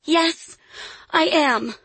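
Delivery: put-on high-pitched little girl's protest; child's high-pitched insistent cry. A narrator's reading. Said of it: "Lily cried".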